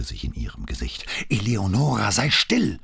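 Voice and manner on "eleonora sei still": urgent, raised-voice plea; imploring call. A narrator's reading. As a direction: call out to stop her, pitched high. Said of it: "rief Ford beschwörend". (beschwörend gerufen)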